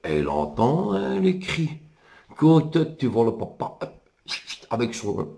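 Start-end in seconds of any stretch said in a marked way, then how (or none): none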